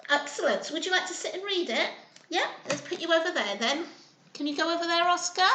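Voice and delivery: ordinary delivery, nothing marked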